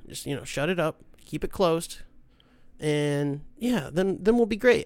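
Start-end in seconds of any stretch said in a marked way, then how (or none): none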